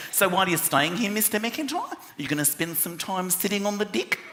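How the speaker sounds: put-on chatty New Zealand accent with deck turned to dick. Receptionist asking a guest at check-in, New Zealand accent. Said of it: "I was checking in and she said".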